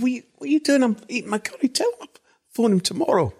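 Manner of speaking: high, indignant squeak of a man mid-curry; high-pitched mock outrage at being disturbed at dinner; exasperated protest. Exasperated at wife, curry interrupted.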